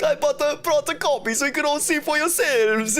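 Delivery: sing-song cartoon Italian accent; hammed-up Italian accent. Photographer testifying in court, hammed-up high Italian accent. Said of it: "the Italian accent is literally the hand motion".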